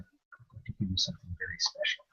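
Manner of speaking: hushed, as if sharing a treasure; hushed secret. Retold or imitated. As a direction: lean in and murmur it like a secret. Confidential gift offer.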